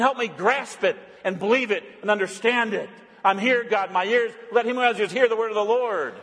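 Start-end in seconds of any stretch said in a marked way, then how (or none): none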